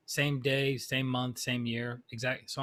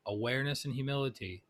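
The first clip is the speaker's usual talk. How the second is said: usual speaking voice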